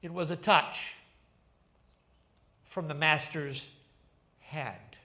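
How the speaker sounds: a lightly voiced gentle reveal with a smile. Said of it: "he said with a smile".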